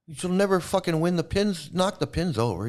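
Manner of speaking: usual speaking voice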